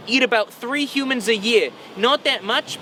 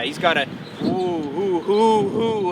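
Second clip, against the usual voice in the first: mock spooky oohs; slower than usual. Mocking spooky ooh sounds.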